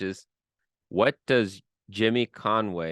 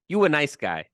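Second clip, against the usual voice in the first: a light, higher-pitched wiseguy voice; mock mobster; 6 semitones higher, 3 dB louder than usual. Host playing mobster affectionately pinching a cheek, mock wiseguy. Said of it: "where you pinch their cheek".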